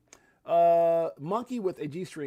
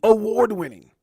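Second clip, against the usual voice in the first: booming announcer call; 4 semitones higher, 9 dB louder than usual. Shown as a demonstration. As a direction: shout it out loud, hype announcer. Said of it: "that's gonna be my thing now".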